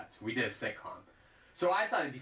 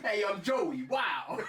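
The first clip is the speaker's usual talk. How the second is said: high laughing Brooklyn voice; Brooklyn accent; 8 semitones higher, much slower than usual. Pretend. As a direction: try a Brooklyn Joey, laughing. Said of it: "How you doing in a Brooklyn accent".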